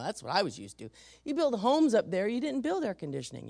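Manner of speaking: ordinary talk, nothing marked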